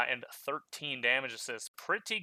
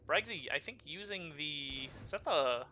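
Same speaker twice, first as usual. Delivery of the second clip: usual speaking voice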